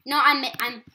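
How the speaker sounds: ordinary delivery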